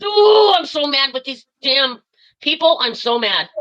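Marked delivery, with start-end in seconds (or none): 0.0-0.5 loud, high exasperated "ooh"; fuming "ooh" before ranting; angry ooh. Real, not acted. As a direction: let the anger burst out in a high "ooh". Furious caller exhales anger.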